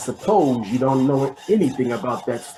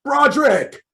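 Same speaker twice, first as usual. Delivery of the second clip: higher, louder call the second time; impatient shout; 12 semitones higher, 5 dB louder than usual. Acted. Boss yells again for the late henchman, impatient shout.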